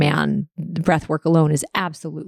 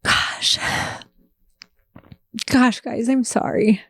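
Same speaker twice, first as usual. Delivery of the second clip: tearful; 3 semitones higher than usual. Real, not acted. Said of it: "I don't know why I'm crying".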